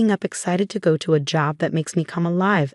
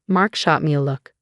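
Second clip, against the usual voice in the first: usual speaking voice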